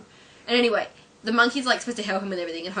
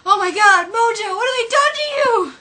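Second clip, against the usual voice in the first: very high, loud cry of dismay; hammed-up aghast shout; mock horror; 13 semitones higher, 10 dB louder than usual. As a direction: wail over the poor animal. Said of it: "And the guy's like".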